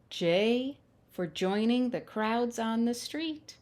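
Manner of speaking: bright, lilting picture-book reading; reading to children. Acted. Storyteller reads the alphabet book to children, lilting. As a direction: read each letter like a treat for little ones.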